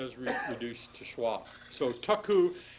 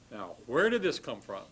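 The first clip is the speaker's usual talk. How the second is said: usual speaking voice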